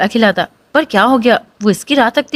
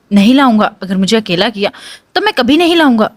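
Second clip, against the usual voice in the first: usual speaking voice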